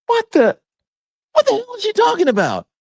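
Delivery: high, loud incredulous outburst; what the hell shriek; outraged disbelief. Real, not acted. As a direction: blurt it out, appalled. Outraged reaction to Perino clip.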